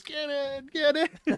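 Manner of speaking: high, urgent mock drill sergeant call; hammed-up high-pitched sergeant's order. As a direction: imitate a sergeant hurrying people inside. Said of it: "drill sergeants at the doors".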